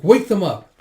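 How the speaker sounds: high, loud command; urgent order. Retold or imitated. Ordering Yun to rouse the sick, urgent.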